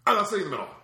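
high, loud flat refusal; imitating a sulking friend's refusal; stubborn angry refusal. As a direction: dig in and refuse, indignant. Dave refusing middle seat, angry.

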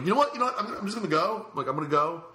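high, loud, flustered excuse; re-enacting a jumpy attempt to leave; acted panic. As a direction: blurt out a hurried need to escape. Acts out anxious excuse to leave.

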